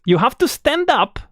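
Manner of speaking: ordinary talk, nothing marked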